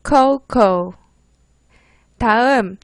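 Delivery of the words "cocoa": "코코" as two English syllables for learners to repeat; model pronunciation. Shown as a demonstration. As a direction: say "코코" with no final "아". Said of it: "따라해보세요". ("코코"를 끝의 '아' 없이 따라 하도록)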